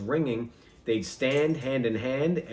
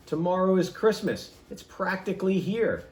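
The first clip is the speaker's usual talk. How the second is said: higher, sneering Grinch line; 6 semitones higher than usual. Acted. Grinch sneering about Christmas, higher mocking sneer. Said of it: "he snarled with a sneer".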